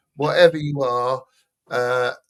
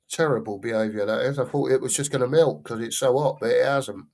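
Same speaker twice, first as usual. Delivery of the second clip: normal talking voice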